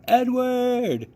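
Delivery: high, loud call of a name; a girl's high calling shout. A narrator's reading. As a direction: call out for Edward across the house. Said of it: "she shouted".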